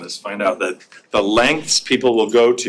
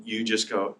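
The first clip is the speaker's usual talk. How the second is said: ordinary talk, nothing marked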